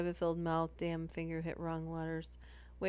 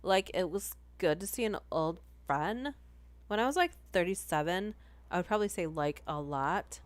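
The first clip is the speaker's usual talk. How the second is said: lightly voiced valley girl, uptalk. Shown as a demonstration. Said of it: "Read it like a valley girl".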